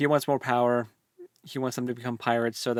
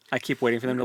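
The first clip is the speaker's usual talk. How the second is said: ordinary talk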